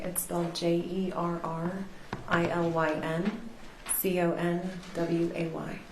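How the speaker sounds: normal talking voice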